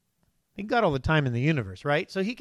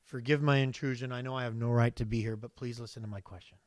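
softer, humble asking prayer; fading to near hush as you ask God's leave; meek, apologetic plea; 5 semitones lower, 6 dB quieter than usual. Shown as a demonstration. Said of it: "I desire to have that tone with the Lord".

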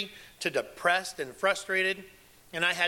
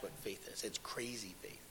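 whispered; 6 semitones lower, 14 dB quieter than usual. Shown as a demonstration. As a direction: whisper "crazy faith" softly, almost unvoiced. Hushed punchline.

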